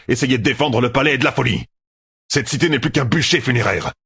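urgent plea. A narrator's reading. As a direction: urge him hard to go. Talmanès pleading with Gibbon.

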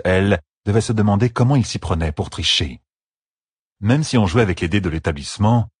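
plain reading voice

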